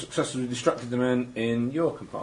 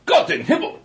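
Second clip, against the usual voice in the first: high, surprised German guard outburst; German soldier exclamation; 5 dB louder than usual. Acted. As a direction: blurt it out as a startled guard. German guard reacting in the game.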